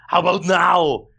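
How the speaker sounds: drawn-out Austrian action-star punchline; Arnold Schwarzenegger. Acted. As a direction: hammer it out in a thick Austrian voice. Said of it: "in an Arnold Schwarzenegger voice".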